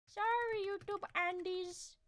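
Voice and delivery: high, soft put-on voice; mock apology. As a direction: apologize in a slow, tiny high voice. Mock apology to YouTube viewers.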